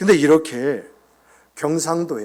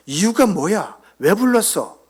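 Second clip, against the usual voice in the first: demanding; 8 semitones higher, 6 dB louder than usual. Retold or imitated. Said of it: "어떤 따지듯한".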